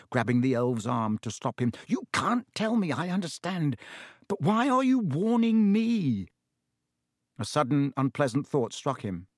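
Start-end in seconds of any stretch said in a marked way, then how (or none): 1.9-6.3 put-on high, urgent boy's voice; crying out. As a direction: press him for an answer, pitch raised. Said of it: "cried Harry".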